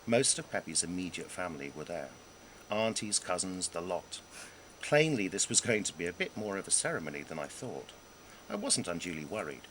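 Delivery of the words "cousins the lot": ordinary delivery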